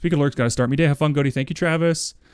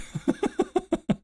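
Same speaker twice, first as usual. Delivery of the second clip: laughing; 4 dB quieter than usual. Real, not acted. Laughing about adding gift.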